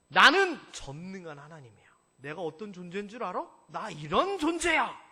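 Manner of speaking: loud, proud voice of God; big confident God boasting; booming declaration. Acted. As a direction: proclaim it like the almighty. God declaring himself to Abraham.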